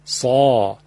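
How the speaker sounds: سا deep and heavy from the throat; emphatic saa. Shown as a demonstration. Teacher demonstrating emphatic ص to listeners. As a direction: give سا full throat volume. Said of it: "پس به این ترتیب".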